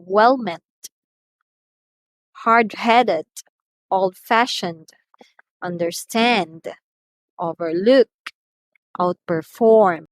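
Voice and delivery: put-on well-MEANT, hard-HEADed, old-FASHioned, underSTAND, overLOOK, outperFORM; stress on last part. Shown as a demonstration. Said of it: "It's on the last part".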